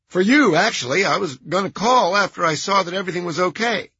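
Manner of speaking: high nasal, fast and eager. A narrator's reading. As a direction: rattle it off, nervous. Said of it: "High-pitched, nasal voice".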